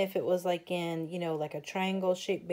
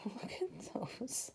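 whispered through a giggle; a soft laugh breaking into a whisper; giggly whisper; 2 semitones higher, 8 dB quieter than usual. Real, not acted. Giggling at cute paper.

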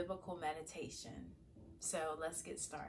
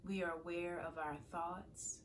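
calm, softer meditation voice with long pauses; guided meditation; 7 dB quieter than usual. Acted. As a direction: guide listeners gently into prayerful stillness, leaving space to breathe. Leads a morning meditation.

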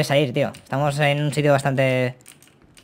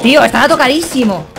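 frustrated; 8 semitones higher, 6 dB louder than usual. Real, not acted. Frustrated after losing fight.

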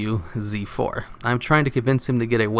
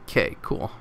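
deadpan reply; 3 semitones lower, 6 dB quieter than usual. Retold or imitated.

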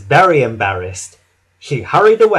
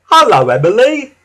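cheerful high, loud greeting; Whiff; 8 semitones higher, 8 dB louder than usual. A narrator's reading. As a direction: whistle out a happy hello. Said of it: "whistled Whiff cheerfully".

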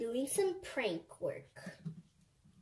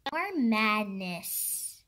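usual speaking voice

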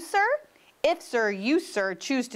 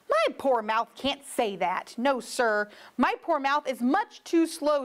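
usual speaking voice

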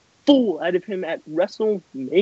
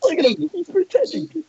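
high, laughter-pitched jeer; amused jeering; 7 semitones higher than usual. Real, not acted. Rival laughing at Steve to the others, amused jeering. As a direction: point him out while cracking up.

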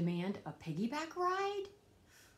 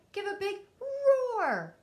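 loud, higher call ending in a shouted roar; 9 semitones higher, 10 dB louder than usual. Acted. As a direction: belt the last word like a roar. Asking the hidden dinosaur to roar.

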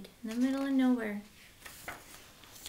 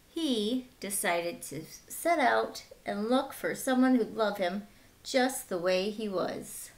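put-on bright, lively read-aloud for kids; lively children's storyteller; storybook narration; 4 semitones higher, 4 dB louder than usual. Reading picture book to children.

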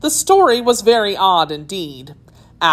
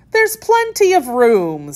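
mildly indignant, higher pitch; 7 semitones higher, 4 dB louder than usual. A narrator's reading. Alice answers the crowded tea party, mildly indignant. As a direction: push back with a touch of indignation. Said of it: "said Alice indignantly".

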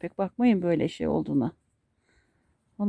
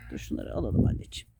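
hushed, whispered endearments to a pet; tender whispered cooing; soft whisper to dog; 5 semitones lower, 8 dB quieter than usual. Real, not acted. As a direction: whisper sweetly to your dog while grooming it. Cooing softly to her dog.

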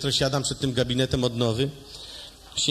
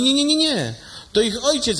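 high, loud no no no; nurse correcting; 9 semitones higher, 4 dB louder than usual. Acted. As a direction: brush him off in a high nurse voice. (pielęgniarka poprawia, wysoko i głośno)

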